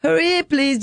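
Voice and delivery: high-pitched little barista voice; imitated young son. Mother imitating her son serving customers, high child voice. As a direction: play a small child hurrying customers along. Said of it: "That kid is six years old. He's, he's like".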